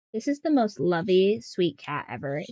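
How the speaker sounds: ordinary talk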